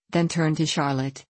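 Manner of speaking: usual speaking voice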